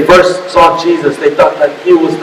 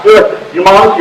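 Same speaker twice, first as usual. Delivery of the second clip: ordinary delivery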